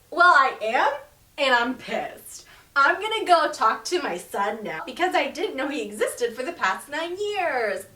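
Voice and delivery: a hammed-up angry father; mock furious.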